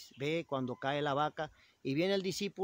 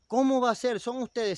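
high, loud shocked gasp of a question; can't believe his eyes, cry it out; astonished disbelief; 7 semitones higher, 7 dB louder than usual. A narrator's reading. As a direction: disciple stunned to find the poor family transformed, loud and high. Disciple recognises the transformed family.

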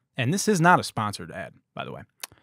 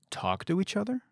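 high, softer disbelieving question; incredulous echo; 8 semitones higher, 3 dB quieter than usual. Retold or imitated. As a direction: repeat it back in mock disbelief, rising at the end. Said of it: "To which I said".

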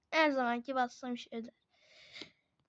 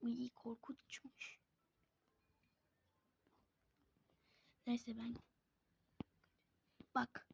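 whispering; 4 semitones lower, 16 dB quieter than usual. Real, not acted. Looking at a scary character.

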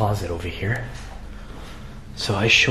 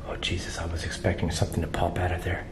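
nervous night vlog whisper; 6 semitones lower, 9 dB quieter, faster than usual. Real, not acted. Expected something to pop out.

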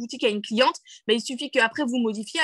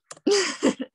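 brief higher-pitched giggle; amused laugh; 6 semitones higher than usual. Real, not acted. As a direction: laugh at your own silly example. Amused by her dog example.

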